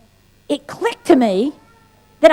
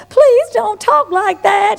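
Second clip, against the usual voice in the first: higher, slow pleading sister voice; sweet sister; 8 semitones higher, slower than usual. Retold or imitated. Sister pleading with her angry younger sibling, sweet and high. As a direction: gently beg her to stop cursing. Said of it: "my sister would say".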